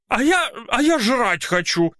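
high hungry wail; whiny shout. Acted. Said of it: "крикнула полная дама". (крик полной дамы)

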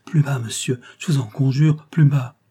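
ordinary delivery

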